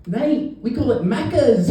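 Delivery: put-on high-pitched Kiwi kid; New Zealand accent. Kiwi kid telling a teammate his slang, high New Zealand accent. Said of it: "the New Zealand kid".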